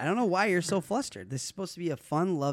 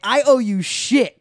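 loud, high, drawn-out defiance, retold; 8 semitones higher, 10 dB louder, much slower than usual. Teenager throwing the money back, loud defiant retelling. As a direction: stretch each word and push it hard.

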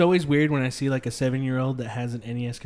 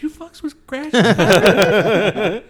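mock excited; 5 semitones higher, 11 dB louder, much slower than usual. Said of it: "I wanted to be like".

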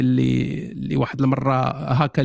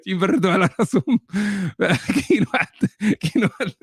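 laughing; 3 semitones higher than usual. Real, not acted. Laughing mockery.

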